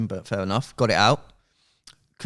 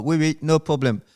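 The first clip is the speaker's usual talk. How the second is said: higher-pitched imitation French accent; 6 semitones higher, 4 dB louder than usual. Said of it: "That's good, good accent".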